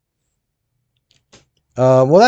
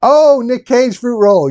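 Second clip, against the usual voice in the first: dawning realization; 10 semitones higher, 5 dB louder, slower than usual. Real, not acted. Sudden realization of a name.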